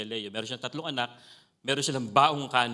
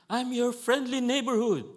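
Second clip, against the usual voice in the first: high, louder cartoonish kid voice; child's voice; 11 semitones higher, 4 dB louder than usual. Preacher voicing the boy's reply, high and playful. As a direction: chirp it like a kid playing a superhero. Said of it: "Sabi nung bata".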